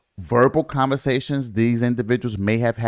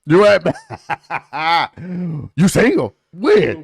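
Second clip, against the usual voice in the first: laughing; 6 semitones higher, 4 dB louder than usual. Real, not acted. Laughing while mocking single status.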